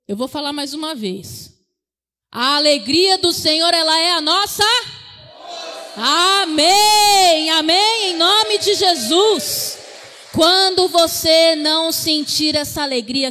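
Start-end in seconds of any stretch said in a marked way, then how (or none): 2.3-13.3 loud, driving sermon declaration; full-force preaching. Acted. Preacher urging the congregation to declare joy, loud and driving. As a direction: proclaim it loud to lift the room.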